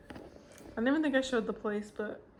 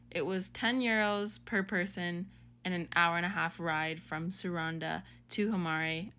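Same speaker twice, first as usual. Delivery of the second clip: normal talking voice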